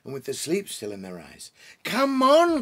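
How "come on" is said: high, loud cry of delight; excited cry. Acted. Beaver urging the children up the bank, high joyful cry. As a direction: cry it out, almost dancing. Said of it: "cried Mister Beaver".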